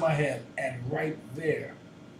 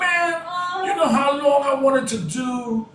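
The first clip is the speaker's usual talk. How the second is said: loud, high, thrilled outburst; excited shout; 8 semitones higher, 9 dB louder than usual. Retold or imitated. Artist shouting to the producer, overjoyed at the dance beat. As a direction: burst out with joy at finally getting the chance. Excited retelling of first studio session.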